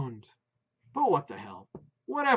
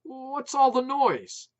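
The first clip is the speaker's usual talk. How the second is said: higher, louder, slow; calling over noise; 10 semitones higher, 7 dB louder, slower than usual. A narrator's reading. Putz asking wife over barking, loud slow call. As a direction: shout it down the line.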